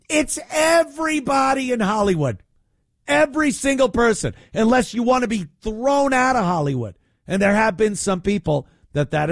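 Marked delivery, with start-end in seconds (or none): 0.1-7.0 shouting, much higher and fast; high, fast exasperated shouting. Real, not acted. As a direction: yell it in disbelief. Ranting loudly about Hollywood.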